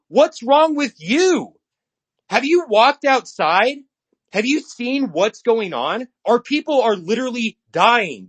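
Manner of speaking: heated, slowed-down outburst; furious rant. Real, not acted. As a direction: shout it back at the critics.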